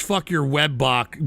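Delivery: ordinary delivery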